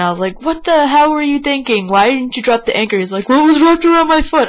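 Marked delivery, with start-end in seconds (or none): 0.4-3.0 high, indignant scolding; retold anger. Boater scolding friend over the anchor, really mad. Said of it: "I got really mad". 3.3-4.5 high, loud, quick excuse; defensive mock whine. Friend excusing himself to angry boater, loudly defensive.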